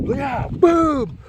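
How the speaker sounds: high, punched celebratory yell; triumphant cheer. Retold or imitated. Runner getting all the way to the top, triumphant. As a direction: re-enact the burst at the summit.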